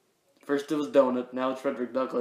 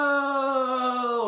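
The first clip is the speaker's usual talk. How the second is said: high cry of cartoon defeat; hammed-up hero's anguish; despairing no; 11 semitones higher, 3 dB louder than usual. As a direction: wail it as the villain escapes. Hero loses the criminal.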